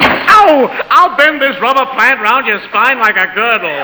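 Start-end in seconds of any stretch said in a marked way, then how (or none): 0.0-0.7 a sharp, higher, loud yelp of pain. Acted. Husband yelping as the plate hits him. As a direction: yelp as if struck.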